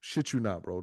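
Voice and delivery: normal talking voice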